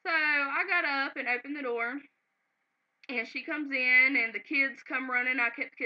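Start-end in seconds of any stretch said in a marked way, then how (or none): none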